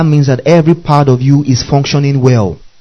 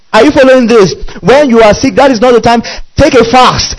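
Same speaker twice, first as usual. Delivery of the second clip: preaching at full force; 6 semitones higher than usual. Acted. Pastor warning sick listeners off movies and news, fiery preaching. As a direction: drive it home like a fiery preacher.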